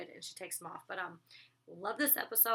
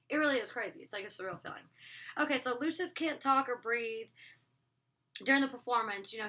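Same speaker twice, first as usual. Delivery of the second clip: ordinary talk, nothing marked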